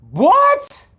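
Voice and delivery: very high-pitched disbelief; incredulous. Acted. Host marvels at Chad Ochocinco, incredulous squeal. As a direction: pitch it way up, amazed.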